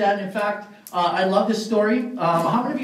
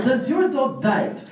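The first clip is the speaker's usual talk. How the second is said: slow, higher-pitched comic inspector voice; Inspector Clouseau; 5 semitones higher, slower than usual. Acted. Inspector asks the hotel clerk about the dog, slow comic impression. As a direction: draw out each word as a bumbling inspector. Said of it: "Inspector Clouseau".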